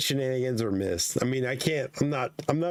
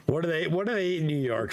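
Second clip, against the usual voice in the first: ordinary talk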